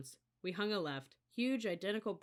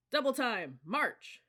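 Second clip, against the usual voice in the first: loud command; 7 semitones higher, 8 dB louder, slower than usual. A narrator's reading. Drill sergeant ordering run.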